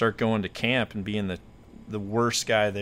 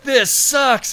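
high-pitched, louder mock outburst; hammed-up angry cry, pitched way up; pissed off; 6 dB louder than usual. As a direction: imitate a furious, pained complaint. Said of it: "He's all pissed".

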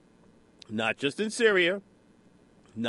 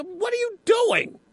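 very high, drawn-out incredulous question; mock outcry; slower than usual. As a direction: imitate a public outcry, pitched way up and slow. Acting out the American people's protest.

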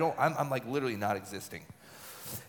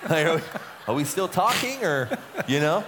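higher, louder, tentative question; awkward check-in; 5 semitones higher, 5 dB louder than usual. Acted. Speaker checks whether his silent counsellor is awake, awkward. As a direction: venture a loud, uncertain check-in.

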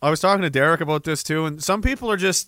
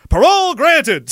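mock verdict shout; 8 semitones higher, 6 dB louder than usual. Parole board verdict, mocked.

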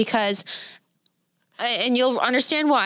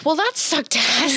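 slow, higher line that breaks into a laugh; 4 semitones higher than usual. Retold or imitated. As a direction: shrug it off and laugh. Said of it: "So then I was like".

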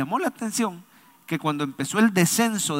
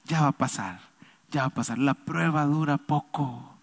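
low, soft soothing voice; loving father; 4 semitones lower, 7 dB quieter than usual. Acted. Preacher voicing God comforting a child in trials, loving. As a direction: hush a child tenderly, voice dropping. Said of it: "Es un padre amoroso que te dice".

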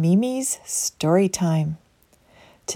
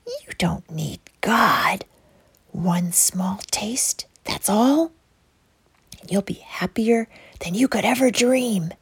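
snake voice; 2 semitones higher than usual. Acted. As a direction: draw the words out like a tempting snake. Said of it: "the serpent whispered".

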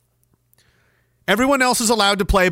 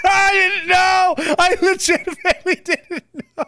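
real astonishment breaking into laughter; shocked surprise; 9 semitones higher than usual. Real, not acted. Reacting to the clip's speaker turning out a woman, real shocked surprise.

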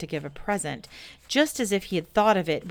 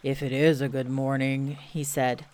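Eeyore voice; 4 semitones lower than usual. Acted. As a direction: drag it out glumly like a sad donkey. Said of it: "said Eeyore".

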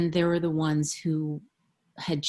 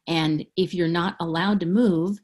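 ordinary talk, nothing marked

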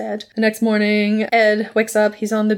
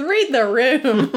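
slow deadpan; 5 semitones higher, slower than usual. Retold or imitated.

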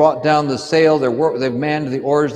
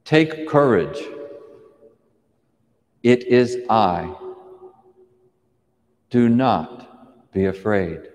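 lower, slow and steady reassurance; calm reassurance; 5 semitones lower, slower than usual. A narrator's reading. Jesus calms the terrified disciples, slow and reassuring. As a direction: settle their fear with each slow word. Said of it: "the figure speaks and says".

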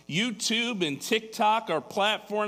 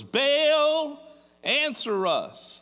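high, loud cry of Baal's name; retold shout; 9 semitones higher, 4 dB louder, slower than usual. Prophets calling on Baal, retold as a fairly loud cry. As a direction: call out to a silent god, pleading. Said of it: "they shouted".

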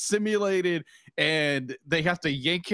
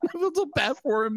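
mock pity; 7 semitones higher than usual. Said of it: "I'm like".

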